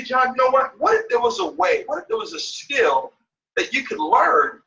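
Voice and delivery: rising, leaning-in questions; inviting pitch. Shown as a demonstration. Coach pitches the skill to John, rising inviting questions. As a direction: lift the questions and lean into them. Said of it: "look at the inflections".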